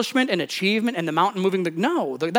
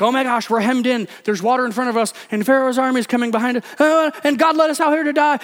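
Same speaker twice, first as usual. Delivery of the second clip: freaking out; 5 semitones higher, faster than usual. Retold or imitated. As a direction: act out a panicking crowd, pitch climbing. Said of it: "they're all freaking out".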